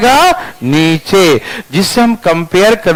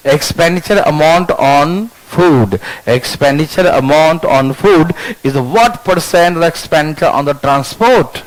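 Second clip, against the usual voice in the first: slow dictation; 3 semitones lower, much slower than usual. Shown as a demonstration. Teacher dictating question slowly.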